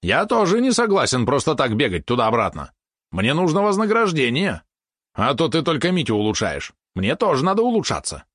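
high, pompous pet demand; self-important. A narrator's reading. Valeron demands a reward for his errand, high and self-important. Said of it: "важно продолжил".

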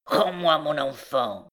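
relentless demand, lightly voiced; a hint of a grim recluse; implacable recluse. A narrator's reading. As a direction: demand it like an obsessed mother. Recluse demands child, against pleading girl.

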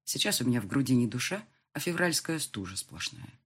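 usual speaking voice

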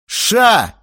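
high, very loud single bark; barked order. A narrator's reading. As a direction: bark for silence. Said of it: "рявкнул я". (рявкнуть, резко и громко)

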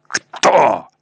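hard question. A narrator's reading. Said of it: "спросил Ахимас в третий раз".

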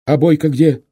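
high, loud demand; loud questioning. A narrator's reading. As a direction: call out the question urgently. Rider anxiously asks after comrades.

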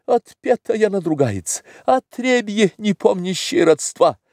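high-pitched indignant tirade; сердитое восклицание. A narrator's reading. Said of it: "в свою очередь рассердился". (гневная, высокая возмущённая тирада)

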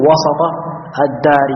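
وسط as wa-sa-Ta, short a after s; fatha on the seen. Shown as a demonstration. Teacher illustrating the rule, opened seen in وسط. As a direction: open the seen of وسط before الدار. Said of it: "تفتح".

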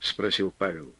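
ordinary delivery

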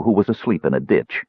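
plain reading voice

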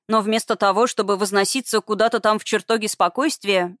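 plain reading voice

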